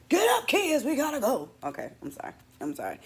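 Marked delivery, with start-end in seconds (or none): 0.1-1.5 put-on high urgent alarm to the kids; panicked call. Mother waking kids during fire scare, high urgent cry. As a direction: rouse the kids as if the house is burning. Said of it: "so I got nervous".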